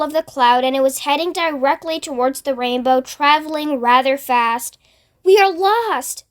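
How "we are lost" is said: despairing cry. A narrator's reading. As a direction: wail that all hope is gone. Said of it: "Miss Spider cried".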